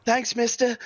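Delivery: put-on high-pitched street kid; high, quick childlike voice; little newsboy. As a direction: pipe up like a small boy. Game master voices the newsboy.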